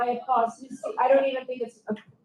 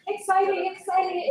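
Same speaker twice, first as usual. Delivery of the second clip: excited; 7 semitones higher than usual. Retold or imitated. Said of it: "and I'm like".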